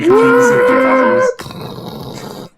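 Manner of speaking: high, slow announcer voice. Pretend. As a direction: announce it like a trailer. Mock announcer spoiler warning.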